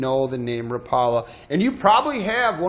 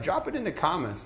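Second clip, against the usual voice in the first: ordinary delivery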